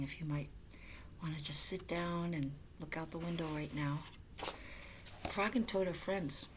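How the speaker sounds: ordinary delivery, nothing marked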